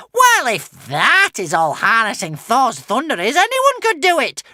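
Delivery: very high, loud witch rage; raging witch. A narrator's reading. Witch belittles Hiccup's feat, raging. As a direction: rage at them in a shrieking witch voice. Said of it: "raged the witch".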